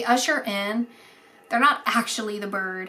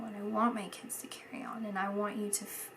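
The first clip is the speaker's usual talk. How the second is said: whisper; 10 dB quieter, faster than usual. Retold or imitated. Medium voicing the dead husband.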